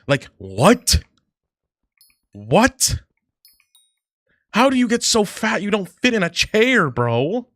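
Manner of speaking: loud, high incredulous what; loud disbelief. Real, not acted. Streamer ranting to chat about the woman who didn't fit, loud and incredulous.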